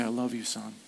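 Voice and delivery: soft, gentle father's voice; tender father. Retold or imitated. Father comforting his son after a spanking, softly tender. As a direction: hold him and say it softly.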